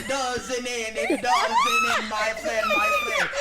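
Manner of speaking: hammed-up Jamaican accent, sung-spoken through laughter; bad Jamaican accent. Singing the game song in a horrible Jamaican accent. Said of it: "That was a horrible Jamaican accent".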